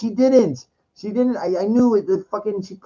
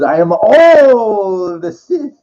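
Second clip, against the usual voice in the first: louder, slow, grand Sith proclamation; booming villain declaration; 5 semitones higher, 7 dB louder, much slower than usual. Retold or imitated. Retelling the climax, slow booming Emperor boast. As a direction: declare it slowly and loud like a dark lord. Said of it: "he goes".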